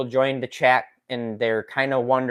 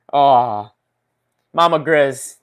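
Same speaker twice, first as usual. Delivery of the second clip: usual speaking voice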